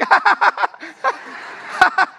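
high eager fake laugh along; forced laugh. Member laughing along at the sermon, high and eager. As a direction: laugh too hard to show you're engaged.

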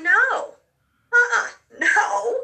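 refusing to leave heaven, loud and high; protesting no. Retold or imitated. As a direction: plead against being sent back. Refusing to go back.

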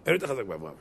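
understated, hushed reply; quiet answer. Acted. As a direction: say it softly, almost under the voice. Answer before emphatic repeat.

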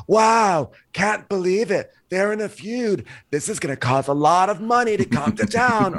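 high-pitched, hammed-up line reading; Lisa Barlow impression. Imitating her cameo in the movie, Lisa Barlow impression. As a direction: put on a high, theatrical cameo voice. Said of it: "'cause she was like".

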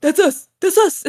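high, eager reply; husband answering the official, high and eager. Retold or imitated. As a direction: jump in quickly like the husband. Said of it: "Warren's like".

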